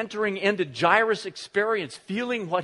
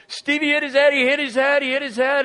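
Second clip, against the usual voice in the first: higher, louder urgent repeating; panicked kids; 8 semitones higher, 7 dB louder than usual. Retold or imitated. Boys running in to tell their parents, panicked. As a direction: blurt it out in a panic. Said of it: "the boys came running up into the kitchen saying".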